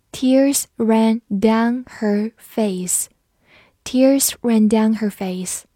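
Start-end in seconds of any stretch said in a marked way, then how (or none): 0.1-3.1 very slow, each word on its own; slow read-through. Shown as a demonstration. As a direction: say it word by word for learners. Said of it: "我们慢读一遍". (一字一顿地慢读)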